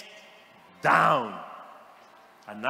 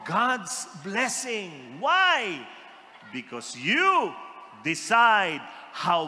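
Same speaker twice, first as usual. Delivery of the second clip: slow emphatic preaching; 2 semitones higher, 4 dB louder, slower than usual. Acted.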